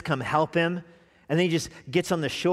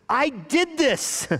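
eager Peter; 11 semitones higher, much slower than usual. Acted. Peter eagerly claims credit.

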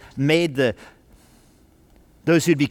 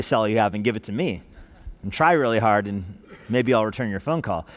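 mock TV preacher; 6 semitones lower than usual. Pastor mocking greedy TV preachers, quick sly aside. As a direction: rattle it off like a greedy TV preacher, lightly mocked. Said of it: "like the T V preachers do".

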